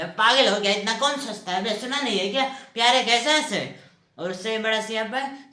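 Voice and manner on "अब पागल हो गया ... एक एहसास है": loud, raised-pitch retort; exasperated outburst. Acted. Boyfriend answering why he loves her, loud exasperation. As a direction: blow up at a needless question.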